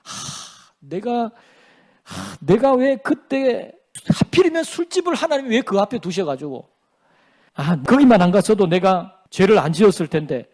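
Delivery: sighing, halting mock lament; sigh, then grumble the blame onto someone else; imitated excuse-maker bemoaning bad luck; self-justifying. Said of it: "자기 합리화예요".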